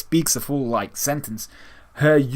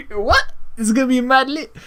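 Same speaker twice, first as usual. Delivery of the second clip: much higher, louder, laughing; laughing disbelief; 13 semitones higher, 5 dB louder than usual. Retold or imitated. Fan laughing at Kami's slang, much higher. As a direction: crack up in disbelief as you repeat it.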